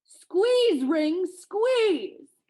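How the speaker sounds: high, full-voiced giant's bellow; bellowed command. A narrator's reading. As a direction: bellow an order with drawn-out, falling cries. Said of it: "Then he bellowed out".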